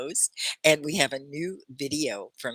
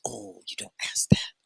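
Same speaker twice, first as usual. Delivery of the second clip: whispered aside. Real, not acted. To a student who asked a rude question, a whisper. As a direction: drop to a whisper, like sharing a secret.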